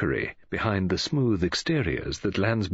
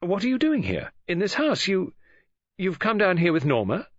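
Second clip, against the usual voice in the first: sharp challenge; 9 semitones higher than usual. A narrator's reading. Mary challenges the uninvited David.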